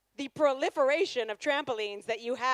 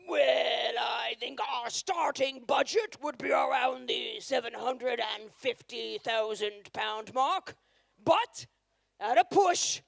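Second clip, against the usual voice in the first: grand plummy voice, slowing on the big numbers; posh couple impression. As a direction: play a smug rich house hunter naming absurd sums. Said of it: "Here is my impression of any of the featured couples".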